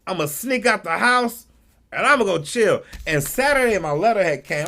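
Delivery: usual speaking voice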